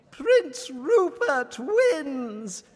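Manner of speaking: high, slow, grand royal announcement; queen's announcement. Acted. Queen announces the winner in a high, slow, grand voice. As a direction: proclaim it slowly to the court. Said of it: "announced the queen".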